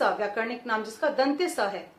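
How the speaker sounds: usual speaking voice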